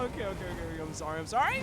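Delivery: ordinary delivery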